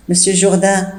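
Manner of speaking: ordinary talk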